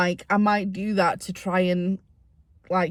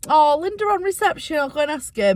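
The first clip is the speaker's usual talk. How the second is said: excited; 7 semitones higher, 3 dB louder than usual. Retold or imitated. Retold bright idea thought.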